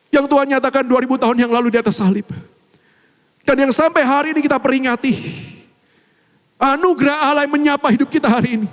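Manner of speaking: rising preaching. Real, not acted. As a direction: drive the point home with urgency. Preaching build. (khotbah yang makin bersemangat)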